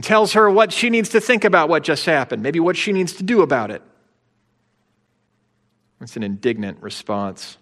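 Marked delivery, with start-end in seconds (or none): none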